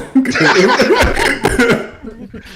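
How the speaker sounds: high burst of laughter. Real, not acted. Teasing a friend about Canadian Tire, high laughter.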